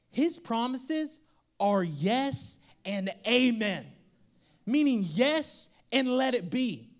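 each phrase drawn out; slow emphatic preaching. Acted. Preacher tells the congregation God's promises are yes and amen, slowly. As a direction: lay it down slowly.

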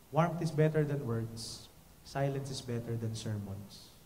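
slow, soft, lowering punchline; slow aphorism. Real, not acted. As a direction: let the maxim sink in.

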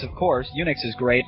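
normal talking voice

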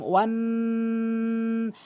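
ওয়ান held long through the nose; nasal ghunna. Shown as a demonstration. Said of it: "নাকের ভিতরে নিতে হবে এরকম".

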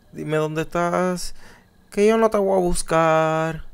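canturreo bajito y burlón, muy despacio; burla suave y lenta. Pretend. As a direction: provoca al monstruo casi en secreto. Burla susurrada al monstruo del juego. (le canta bajito al monstruo para provocarlo, burlón y lento)

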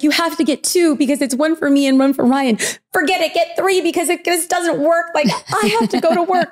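frantic orders. Retold or imitated. Panicked wife sending her husband for lice treatment.

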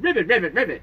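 lightly voiced frog babies croaking; babies' ribbit chorus. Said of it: "all the babies said".

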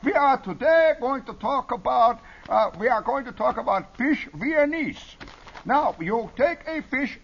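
high, loud mock Viennese TV chef; slow, high-pitched phony Viennese chef; phony Viennese accent. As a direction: lecture in a hammed-up Viennese accent. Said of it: "a Viennese chef or something".

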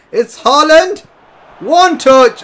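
excited gamer calling his striker's run. Real, not acted. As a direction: call the chance as it happens. His striker breaks through.

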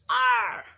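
loud roaring arr; croaky pirate roar. Acted. Pirate roaring at the port, loud and croaky. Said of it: "a loud croaky voice roaring".

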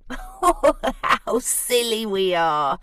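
slow, laughing confession; jiggling with laughter. Acted. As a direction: chuckle through the words. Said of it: "all a jiggle".

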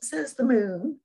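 plain reading voice

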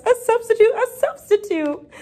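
high, loud excited exclamation; delighted. A narrator's reading. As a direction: pass on thrilling news, pitched high. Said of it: "They were delighted".

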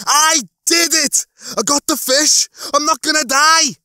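very high, loud jubilant yelling; triumphant scream. A narrator's reading. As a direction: shout it out in wild relief. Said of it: "He did a victory dance".

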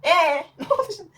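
high, loud laughing question; giggling disbelief at the first cut. Real, not acted. Laughs at her own cut.

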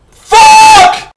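very high, loud shouted curse; furious shout; frustrated yell. Real, not acted. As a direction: scream it at the flubbed take. Frustration at mistake.

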